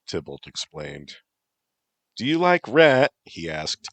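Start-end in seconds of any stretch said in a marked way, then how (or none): 2.1-3.1 loud over music. Acted. Tybalt asking over loud music.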